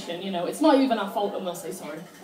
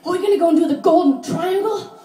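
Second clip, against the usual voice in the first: higher, louder eager question; the thrilled mum planning the tour; excited mum; 7 semitones higher, 5 dB louder than usual. Retold or imitated. Comic voicing her excited mum to the audience, higher and louder. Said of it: "My mum was really excited".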